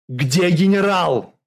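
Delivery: drawn-out, loud, high yell. A narrator's reading. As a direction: bellow the question slowly. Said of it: "заорал Журбин". (заорать)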